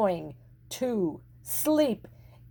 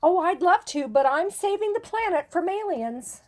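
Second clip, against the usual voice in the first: put-on higher, eager little astronaut boy; little boy's excuse; 8 semitones higher than usual. A narrator's reading. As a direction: brush mom off like a busy astronaut kid. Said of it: "he told his mother".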